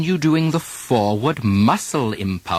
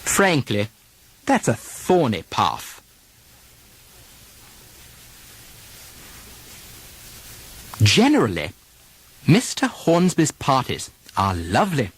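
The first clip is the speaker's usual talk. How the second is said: British RP sentences. Shown as a demonstration. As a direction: model each sentence in British RP. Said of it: "Repeat them after me".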